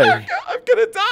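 high squeal through real laughing; helpless laughter. Real, not acted. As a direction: laugh so hard the words come out squealed. Cracking up at the prank call.

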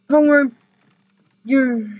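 usual speaking voice

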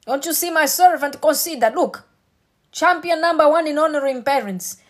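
high and loud, building to full preaching force; preaching climax. Real, not acted. Preacher telling the church God defends them, loud and high.

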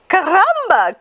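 high, loud chicken exclamation; excited cry. A narrator's reading. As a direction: cry it out high, falling at the end. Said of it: "she cried".

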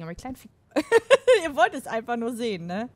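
normal talking voice

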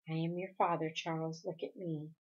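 low, soft fatherly appeal; tender. A narrator's reading. Father asks his little boy to look, tender. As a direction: coax the child gently, keep it hushed. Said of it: "His voice was tender".

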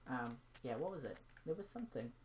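ordinary talk

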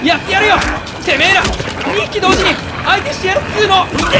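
loud, high fired-up battle shout; tough-guy shout. Real, not acted. Player taking on two enemies at once, rough fired-up shout. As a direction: psych yourself up and yell a challenge.

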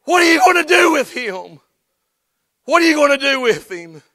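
loud, high preaching challenge; preaching call. Acted. Preacher challenging the congregation, full force. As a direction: press the question on the congregation.

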